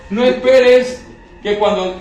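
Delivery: slow and loud, leaning on esperes; preacher's emphatic warning to the congregation. Real, not acted. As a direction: hammer out "tú no esperes" slowly.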